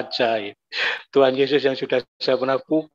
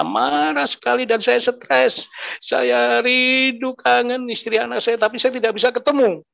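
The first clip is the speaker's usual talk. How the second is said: anguished longing; 9 semitones higher than usual. Retold or imitated. Man recalls missing wife and children, anguished longing. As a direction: stretch the feeling words, voice raised high in anguish. Reliving anger and longing for family.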